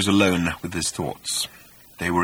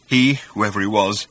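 usual speaking voice

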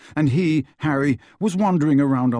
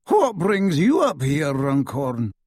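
slow, loud, lofty official; pompous minister; 4 semitones higher, 4 dB louder, slower than usual. A narrator's reading. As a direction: drawl it out from on high. Minister voiced in story.

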